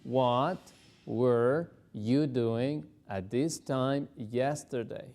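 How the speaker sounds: drawn out word by word; very slow drill. Shown as a demonstration. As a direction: go very slowly for home practice. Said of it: "repetir muy despacito".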